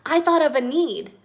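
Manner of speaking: high, loud burst of discovery; child character piping up loudly; excited shout. A narrator's reading. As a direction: call out a sudden idea. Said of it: "Mia shouted".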